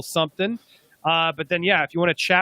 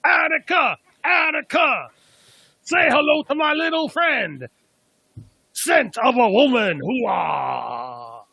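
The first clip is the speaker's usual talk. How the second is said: Fire Marshal Bill and Al Pacino; 7 semitones higher, slower than usual. Retold or imitated. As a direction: yell it like a crazed fire marshal. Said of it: "Now do it mixed with some Fire Marshal Bill".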